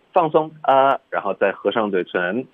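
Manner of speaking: usual speaking voice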